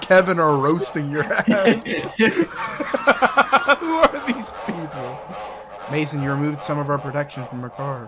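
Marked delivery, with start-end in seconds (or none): 1.4-5.2 slow, high, laughing. Real, not acted. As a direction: laugh it out slowly. Laughing at chat.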